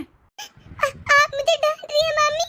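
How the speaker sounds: put-on high, tearful child's complaint; रोता बच्चा. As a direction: whine it like a hurt little kid about to cry. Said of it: "तू इस तरह रो क्यों रहा है". (रोते बच्चे की ऊँची आवाज़ में)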